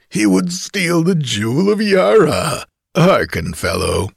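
coarse, boastful tavern braggart; drunken bragging rogue. A narrator's reading. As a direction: gloat like a drunk kidnapper. Kothian mocks the stranger.

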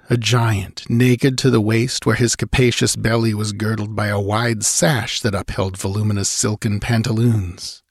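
ordinary delivery, nothing marked

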